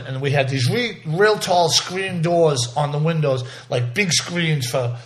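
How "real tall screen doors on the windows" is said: ordinary delivery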